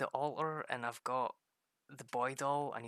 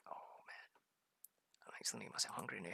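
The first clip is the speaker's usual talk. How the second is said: fast whisper; 3 semitones lower, 8 dB quieter, faster than usual. Real, not acted. Craving the toast.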